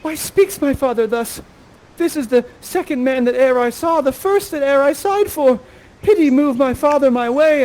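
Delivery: high, loud Shakespearean pleading; pleading young heroine. Acted. Miranda pleads over her father and first love, high and imploring. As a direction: implore the heavens, pitched high and urgent. Performs Miranda's lines in Tempest retelling.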